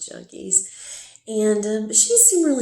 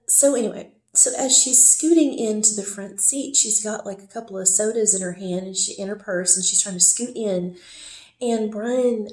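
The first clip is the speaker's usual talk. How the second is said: ordinary talk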